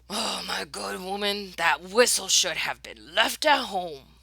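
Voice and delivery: a different, pompous man's voice; fussy passenger complaining to Mama; stuffy gentleman. Acted. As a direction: sniff at the whistle.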